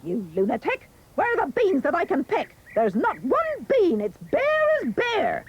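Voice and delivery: shrieky high mother, climbing in pitch; scolding mother. A narrator's reading. Mother finding no beans to pick, high scolding voice. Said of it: "The mother said".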